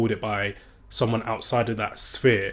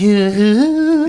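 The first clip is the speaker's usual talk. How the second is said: put-on high, loud drunken wail; imitated drunk rapper's squealed noise; 10 semitones higher, 8 dB louder than usual. Showing the rapper's drunk personality, high drunken wail. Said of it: "that's supposed to be him drunk".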